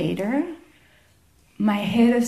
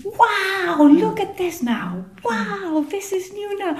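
put-on childlike wonder; 9 semitones higher than usual. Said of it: "enjoying it like a child".

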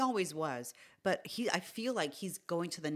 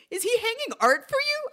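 amazed; 11 dB louder than usual. Retold or imitated. Manager's shocked phone reaction.

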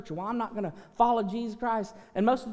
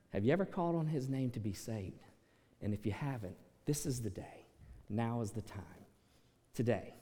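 low, soft pastoral invitation; hushed altar-call plea; 10 semitones lower, 6 dB quieter than usual. Acted. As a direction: pull them in gently, almost confiding.

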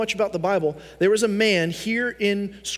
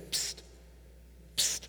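whispered psst; 15 dB quieter than usual. Retold or imitated. Paul signals guard from cell.

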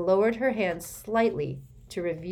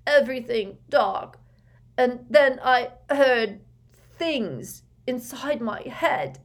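higher, slow, haunted voice; shaken and scared; 7 semitones higher, 6 dB louder, slower than usual. Acted. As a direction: relive the horror, halting. Terrified son describing dementor attack.

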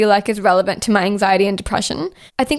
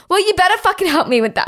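sharp, fast, high-pitched demand; indignant demand; 8 semitones higher, faster than usual. Retold or imitated. Frustrated tenant snapping at the agency, fast and sharp. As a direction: snap it at the customer service agent. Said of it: "on the verge of going full Karen".

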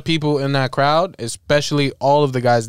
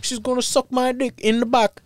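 high-pitched, badly faked British accent; British accent attempt; 7 semitones higher than usual. Voicing a British pop star on stage, British accent. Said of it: "[name] is British, right?".

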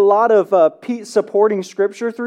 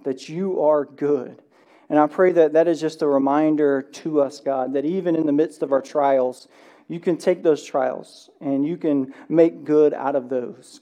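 low, soft, measured prayer voice; hushed, reverent pastor's prayer; congregational prayer; 4 semitones lower, 4 dB quieter than usual. Real, not acted. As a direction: pray gently on behalf of the room, in low tones.

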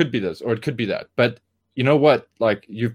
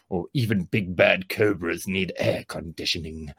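low, slow villain voice; deep menacing drawl; Felix Stratton voice; 5 semitones lower, 5 dB quieter than usual. Shown as a demonstration. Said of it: "I go".

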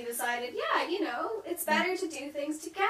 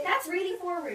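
ordinary delivery

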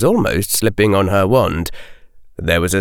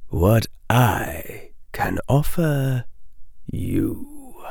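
slow, hushed, dry whisper; slow rasping whisper from the mist; sibilant whisper; 7 dB quieter, much slower than usual. A narrator's reading. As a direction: whisper it like dust from the dark.

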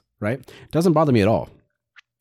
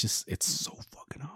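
voiceless, much softer emphasis; overwhelmed whisper; 13 dB quieter than usual. Retold or imitated. Man confessing sriracha is too spicy for him, overwhelmed whisper. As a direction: drop to a breathy whisper for emphasis.

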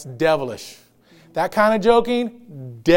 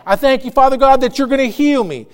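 loud, fervent thanksgiving prayer; preacher voicing a believer's bold prayer of thanks; confident prayer; 3 semitones higher, 5 dB louder, faster than usual. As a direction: declare it boldly to God, louder and higher. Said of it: "all he does is speak what God has already told him".

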